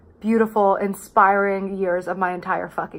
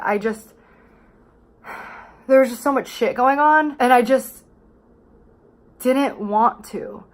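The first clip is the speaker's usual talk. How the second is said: ordinary talk, nothing marked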